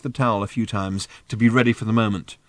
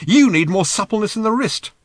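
high, louder indignant retort; protesting; 11 semitones higher, 5 dB louder than usual. A narrator's reading. Arthur criticising Ford's towel technique back, protesting indignantly. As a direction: turn the criticism back, pitched well up. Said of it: "protested Arthur".

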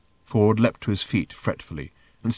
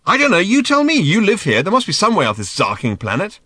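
loud, high, exasperated outburst; 11 semitones higher, 7 dB louder than usual. A narrator's reading. Ford demanding Arthur find a way off, exasperated. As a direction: throw it back at him loudly.

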